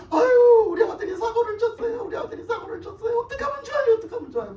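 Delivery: high, loud mock sobbing plea; imitated tearful wailing. Distraught mother phoning her pastor about her son, imitated crying. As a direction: wail it out as if crying down the phone. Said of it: "울면서 전화가 왔어요".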